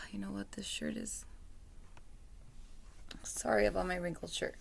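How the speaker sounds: breathy whispered aside; whispering. Real, not acted. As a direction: whisper the apology off-mic. Aside apologizing about her shirt.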